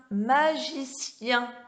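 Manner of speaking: MAGICIEN slowly, ending in a gliding yin; stressed yin glide. Shown as a demonstration. Teacher modelling the sound, MAGICIEN with slow yin ending. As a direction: draw out MAGICIEN syllable by syllable into yin.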